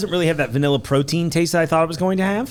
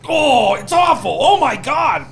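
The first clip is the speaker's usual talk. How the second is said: high, loud, drawn-out revulsion; hammed-up high-pitched dismay; mock disgusted customer; 12 semitones higher, 3 dB louder, slower than usual. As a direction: act out a horrified taste test. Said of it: "they're like".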